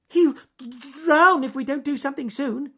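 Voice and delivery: stammering, very high and loud; frantic squeak. Acted. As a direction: squeak in panic, stuck on the d. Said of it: "and squeaked".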